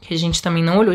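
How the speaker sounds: usual speaking voice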